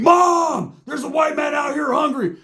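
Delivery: high shouted call into the house; yelling for mom. Retold or imitated. Roach hollering to his mom indoors. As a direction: bellow it toward the kitchen.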